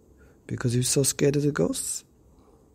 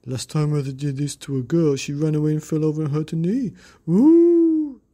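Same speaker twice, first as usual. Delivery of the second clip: high, loud wailing ghost; spooky ghost; 8 semitones higher, 7 dB louder than usual. Acted.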